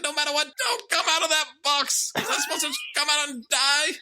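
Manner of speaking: very high, squeaky mock protest; hammed-up squeaking impression of an upset son; Jaden. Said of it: "Just let me do the impression".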